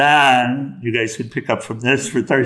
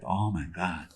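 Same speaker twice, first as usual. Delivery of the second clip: quiet, low mock dismay; deflated, hushed realization with a touch of laughter; devastated; 4 semitones lower, 11 dB quieter than usual. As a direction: let it sink in, softly.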